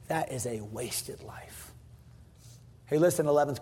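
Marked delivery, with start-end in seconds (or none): 0.1-1.7 slow, much softer and lower; grave verdict. Acted. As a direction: let it fall heavy and quiet. God's verdict, dropped low.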